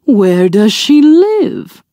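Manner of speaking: slow, sly wolf voice; wolf asking. A narrator's reading. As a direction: draw the question out like a scheming wolf. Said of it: "asked the wolf".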